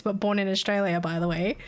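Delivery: normal talking voice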